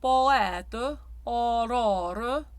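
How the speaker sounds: poète and aurore with a wide open O. Shown as a demonstration. Coach demonstrating the open O to singers. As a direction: aurore with an open, dropped-jaw O. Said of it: "The open O".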